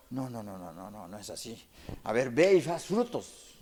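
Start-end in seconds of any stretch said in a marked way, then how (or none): 0.1-1.7 hushed no, no, no, dropping almost to a whisper; soft denial. Real, not acted. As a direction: shake it off quietly, under the breath. Preacher rejecting the idea. (negación en voz muy baja)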